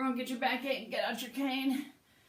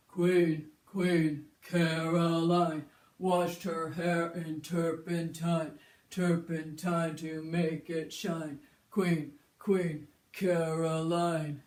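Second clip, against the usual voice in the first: put-on slow, low old grandpa chant; 4 semitones lower, much slower than usual. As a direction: creak along like an old man with a backache. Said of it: "grandpa voice".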